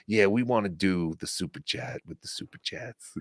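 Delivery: low, quiet droning imitation; lightly mock someone hypnotized by his own catchphrase; hypnotized host. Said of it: "He's, like, hypnotized".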